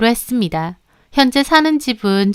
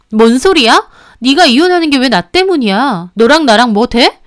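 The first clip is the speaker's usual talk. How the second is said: louder, contemptuous; scornful anger; 3 semitones higher, 6 dB louder than usual. Acted. Mistress rejects any relationship, scornful. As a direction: sneer him down. Quarrel in recorded phone call.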